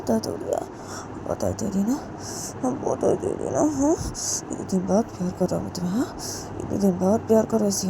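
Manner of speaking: breathy intimate character voice. Acted. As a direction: coax and moan through the lovers' scene. Voices lovers' scene in character.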